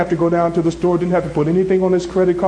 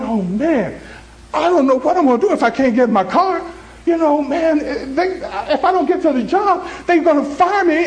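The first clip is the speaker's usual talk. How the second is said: higher-pitched mock worried moan; moaning and complaining; 7 semitones higher than usual. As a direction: imitate a friend fretting and complaining. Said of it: "moaning and complaining".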